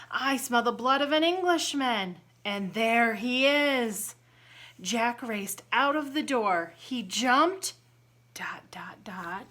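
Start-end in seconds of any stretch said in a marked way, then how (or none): 0.1-4.1 giant voice. Acted. Giant chant continues. 8.3-9.4 soft whispered build-up; suspense whisper. A narrator's reading. Suspense cue in story.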